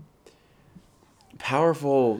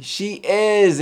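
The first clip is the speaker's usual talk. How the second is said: upward inflection; 9 semitones higher, 6 dB louder than usual. Shown as a demonstration. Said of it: "upward inflection".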